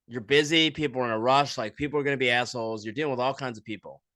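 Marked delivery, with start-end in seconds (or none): none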